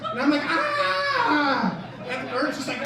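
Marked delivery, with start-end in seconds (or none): 0.5-1.8 put-on very high yelp of a panicked kid; mock scream. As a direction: shriek like a dove is on you. Kid attacked by dove.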